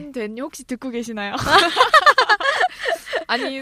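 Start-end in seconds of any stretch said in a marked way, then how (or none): 1.4-3.2 loud high laugh; high burst of laughter. Real, not acted. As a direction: break into big laughter. Laughing at commenter shoutout.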